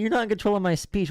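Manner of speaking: high, taunting parrot of a complaint; mocking whiny baby. Debater parroting the opponent's complaint about control, mocking high whine. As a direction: mimic the opponent in a high-pitched mock whine. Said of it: "You're such a baby. Stop crying".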